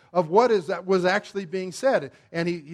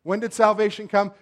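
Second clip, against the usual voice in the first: normal talking voice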